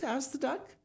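ordinary delivery, nothing marked

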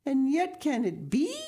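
high, disbelieving exclamation; astonished. A narrator's reading. Peter's mother can hardly believe Heidi is back, astonished. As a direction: ask it high, amazed. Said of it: "with astonishment".

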